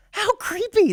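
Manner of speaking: high, disgusted exclamation; creeped out. Real, not acted. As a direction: recoil at it, voice shooting up. Reaction to the robot.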